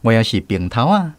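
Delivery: ordinary delivery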